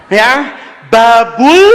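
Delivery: ordinary talk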